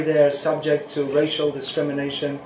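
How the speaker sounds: usual speaking voice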